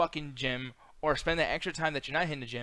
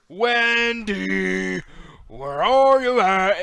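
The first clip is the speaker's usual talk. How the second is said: slow, loud drawn-out call; mock ring call; 5 semitones higher, 12 dB louder, much slower than usual. Mocking a fat wrestler's entrance with a slow loud call.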